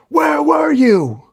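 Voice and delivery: very high, drawn-out shout; anguished yell. A narrator's reading. Dylan shooting Venom with electricity, drawn-out accusing yell. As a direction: scream it slowly, stretching the words. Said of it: "and yells".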